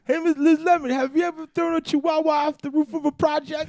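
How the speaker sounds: high, loud catchphrase; very high, loud comedian impression; Tracy Morgan. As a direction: call the name high and loud as a caricature. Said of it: "You got that Tracy Morgan".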